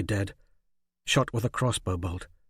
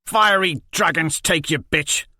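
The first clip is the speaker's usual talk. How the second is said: loud, higher cursing yell; angry curse; 7 semitones higher, 7 dB louder than usual. Acted. Liz Hen curses Jenko in a loud angry yell. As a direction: spit the curse out.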